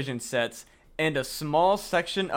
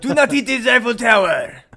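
loud, high hammed-up French accent; bad French accent; 9 semitones higher, 11 dB louder than usual. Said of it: "My bad French accent".

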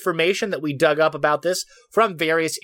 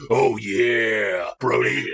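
deep voice; 7 semitones lower, much slower than usual. Pretend. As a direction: drop the voice way down for the joke. Host repeats his deep-voice bit.

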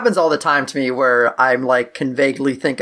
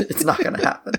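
squeaky wheezing laugh through words; faster than usual. Real, not acted. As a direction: laugh through the whole line. Laughing reply to joke.

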